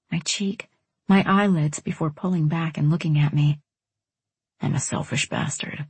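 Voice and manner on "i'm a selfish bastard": low, soft, near-whispered admission; hushed confession. A narrator's reading. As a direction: own up to it very softly. Apology in tender reconciliation.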